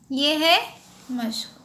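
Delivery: ordinary delivery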